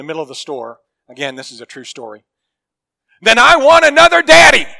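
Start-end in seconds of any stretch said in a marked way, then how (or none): 3.2-4.7 put-on high, loud child's tantrum cry; loud tantrum shout. Three-year-old daughter yelling at her daddy in the store, loud high tantrum shout. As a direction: yell it like a furious little girl stomping off. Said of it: "says in a really loud voice".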